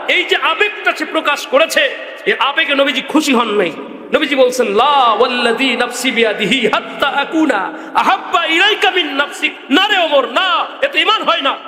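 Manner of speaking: high, urgent sermon voice with chanted Arabic; impassioned preaching. Real, not acted. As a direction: preach the correction in a high, emphatic call. Preaches with recited quote. (চড়া সুরে জোরালো ওয়াজ)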